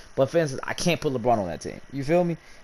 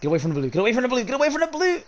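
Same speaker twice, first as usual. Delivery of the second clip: rising higher with each repeat, frantic; frantic pleading; 11 semitones higher, much faster than usual. Real, not acted. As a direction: urge it away, climbing into a high panic. Pleading with the game wheel.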